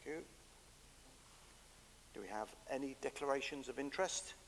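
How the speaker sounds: normal talking voice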